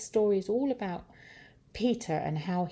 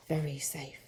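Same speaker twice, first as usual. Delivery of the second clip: hushed, confiding whisper; soft storyteller's whispered echo; 3 semitones lower, 8 dB quieter than usual. A narrator's reading. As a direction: whisper it like a secret. Echo of safe line, whispered.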